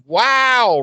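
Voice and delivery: high, drawn-out wow; mock appalled. Host reacting to Riley's insult to Buffy, appalled. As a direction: exclaim in exaggerated disapproval.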